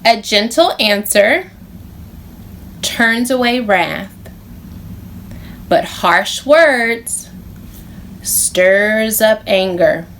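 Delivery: slow, phrase by phrase verse; measured Bible verse; slow recitation. Shown as a demonstration. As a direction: recite it for the kids to echo. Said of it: "Repeat after me".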